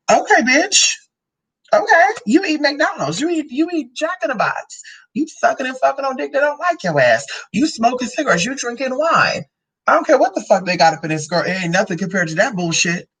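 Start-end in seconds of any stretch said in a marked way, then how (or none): 0.1-9.5 high-pitched, indignant clapback; sassy retort. Acted. Answering someone who faults the grape juice, sassy. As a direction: pitch it up and sass the critic.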